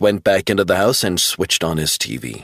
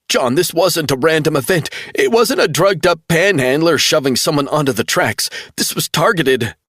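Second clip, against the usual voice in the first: much higher, urgent pleading; desperate insistence; 9 semitones higher than usual. A narrator's reading. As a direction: make him believe you. Travell insisting.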